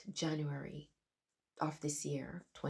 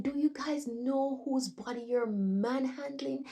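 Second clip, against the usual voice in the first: high, loud, slow outraged demand; retold scream; 8 semitones higher, 4 dB louder than usual. Dreamer screaming at staff behind glass doors, high outraged shout. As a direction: hold each word, high and loud. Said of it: "And I was screaming".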